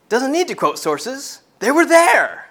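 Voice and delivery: rising emphatic preaching. Real, not acted. Preacher to congregation, rising to a high emphatic peak. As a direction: voice climbs high on They were there. Preacher stressing eyewitness authority.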